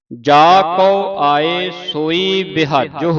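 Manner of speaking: measured, slow chant of the verse; slow scripture recitation. Acted. Teacher reciting gurbani slowly for a student, measured and deliberate.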